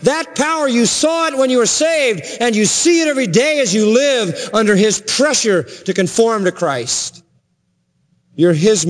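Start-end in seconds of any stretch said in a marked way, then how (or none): 0.0-7.2 high-pitched, insistent preacher's delivery; impassioned preaching. Acted. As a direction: drive each phrase home from the pulpit, pitch held high. Preaches at full force.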